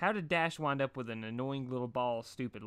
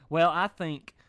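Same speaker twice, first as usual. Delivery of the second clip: Pinkie voice; 6 semitones higher, 10 dB louder, slower than usual. A narrator's reading.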